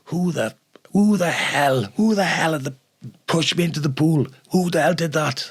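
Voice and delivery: high-pitched outraged shouting; livid yelling. Retold or imitated. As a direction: play the soaked furious photographer demanding who pushed him. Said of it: "he was absolutely livid".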